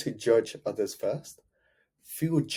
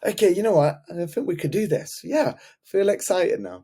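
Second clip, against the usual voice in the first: pitched-up excited reply; client suddenly excited; 8 semitones higher, 4 dB louder than usual. Retold or imitated. Client won over tells coach he feels excited. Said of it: "He goes".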